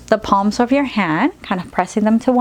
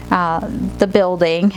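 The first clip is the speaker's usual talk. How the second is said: ordinary delivery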